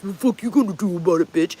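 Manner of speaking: fast, lightly voiced belligerent drunk; mock drunk threat, quick; drunk guy. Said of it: "he got in her face".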